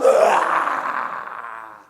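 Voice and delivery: loud, low monster roar; long full-volume beast roar; werewolf roar. Acted. Werewolf appears on balcony rail.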